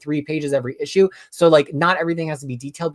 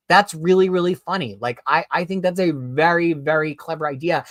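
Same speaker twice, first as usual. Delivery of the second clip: ordinary talk, nothing marked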